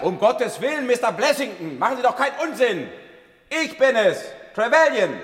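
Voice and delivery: high, loud call up the stairwell; calling up urgently. Acted. Doctor shouts up to the armed Blessington, high and urgent. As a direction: shout up to calm a scared man.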